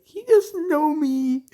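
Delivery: high, loud, drawn-out squeal; mock dismay. Uraraka in her mind as he fails to recognise her, mock dismay.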